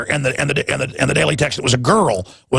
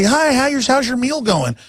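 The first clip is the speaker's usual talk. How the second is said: friendly greeting; 9 semitones higher than usual. Retold or imitated. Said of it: "I'll go over and say".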